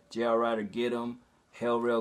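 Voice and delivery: ordinary talk, nothing marked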